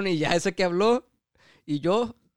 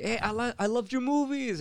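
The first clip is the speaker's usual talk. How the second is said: high, slowed gushing; imitated fan gushing; 8 semitones higher, 4 dB quieter than usual. Coworker tells the actor he loves his movies, gushing. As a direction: play an admiring fan, pitched up.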